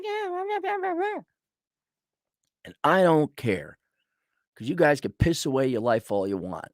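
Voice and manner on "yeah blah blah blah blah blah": high, quiet bored blah-blah; mocking disinterest, high and soft; dismissive blah. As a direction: brush them off. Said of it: "I'm like".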